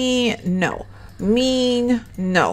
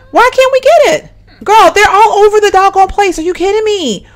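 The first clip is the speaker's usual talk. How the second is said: loud, high-pitched exasperation; exasperated outburst; 8 semitones higher, 5 dB louder than usual. Real, not acted. Player finds roaches all over the place, exasperated outburst. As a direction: cry out, fed up and incredulous.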